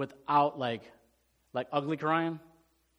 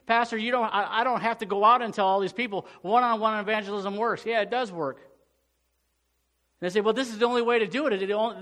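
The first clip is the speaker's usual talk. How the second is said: louder, higher preaching, pressing every phrase; full-force preaching; 6 semitones higher, 4 dB louder than usual. Acted. Pastor preaching to his church, full force and raised. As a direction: drive the point home to the congregation.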